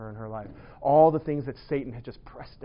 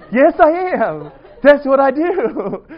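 high, loud, slow, laughing words; laughing loudly through a slowed yes I am; laughing tease; 9 semitones higher, 12 dB louder, slower than usual. Retold or imitated. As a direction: gleefully own it while laughing. Teasing reply retold.

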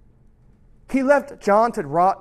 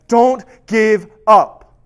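slow, loud, drawn-out plea; slow emphatic urging; 2 semitones higher, 10 dB louder, much slower than usual. Retold or imitated. Jesus urging imprisoned John, slow and loud. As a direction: stretch each word to press it home.

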